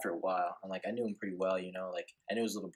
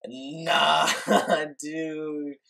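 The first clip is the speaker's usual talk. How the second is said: loud, higher, drawn-out no through laughter; laughing refusal; 7 semitones higher, 9 dB louder than usual. Retold or imitated. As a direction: refuse in amused disbelief, breaking into laughs. Acted laughing refusal to friend's mom.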